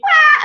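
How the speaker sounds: very high, loud wah; piercing imitated wail; baby wail. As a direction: cry out like a baby. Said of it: "the baby".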